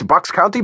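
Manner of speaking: Bucks County rising as a guess; asking where he lives; questioning rise. A narrator's reading. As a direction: lift the end of County into a question. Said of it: "Where do you live in the States?".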